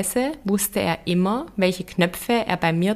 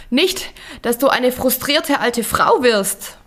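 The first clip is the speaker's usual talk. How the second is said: higher, louder, out of breath from climbing; panting Herbert; 5 semitones higher, 4 dB louder than usual. A narrator's reading. Herbert stumbling behind, panting out his warning. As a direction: gasp it out while hurrying uphill. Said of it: "hörte ich ihn noch keuchen".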